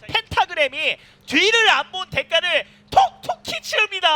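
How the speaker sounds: high, loud, slow and emphatic; excited commentary. Real, not acted. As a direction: drive each word home in hype. Caster hypes a punishing play.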